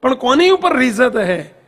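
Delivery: ordinary delivery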